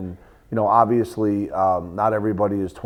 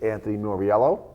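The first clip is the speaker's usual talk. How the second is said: ordinary talk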